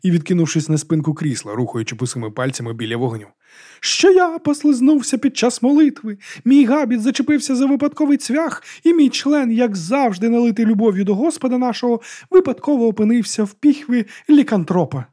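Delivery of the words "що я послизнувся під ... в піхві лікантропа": high, loud mock confession; shrilly pitched lilting parody; sing-song. A narrator's reading. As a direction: sing out the excuse, mocking a penitent. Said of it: "заспівала Батиста".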